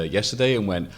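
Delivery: ordinary delivery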